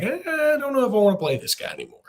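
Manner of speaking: high, put-on voice of a doubting inner self; reluctant inner voice. Torn fan voicing the side that dreads a rematch, high reluctant whine. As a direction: whine it like you want to back out. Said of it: "Part of me is like".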